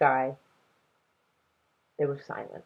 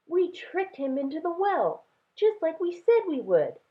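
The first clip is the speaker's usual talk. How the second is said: put-on child voice; 13 semitones higher than usual. Child voice in story.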